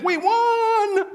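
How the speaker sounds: very high, loud cheer; team shouting. Acted. Other team cheering over Grover, high loud shout. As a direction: shout the win. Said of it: "The other team shouted".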